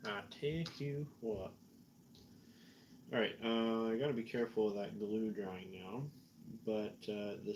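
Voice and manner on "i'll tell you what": put-on Southern accent, "tell" as "tay". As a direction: play a folksy Southerner. Carries on the put-on Southern catchphrase.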